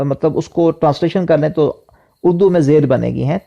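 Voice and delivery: ordinary talk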